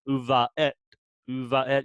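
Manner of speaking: "U, va, et" with a slight catch before "et"; glottal stop. Shown as a demonstration. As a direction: stop the air in the throat between "va" and "et". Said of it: "stopping of the air in the back of the throat".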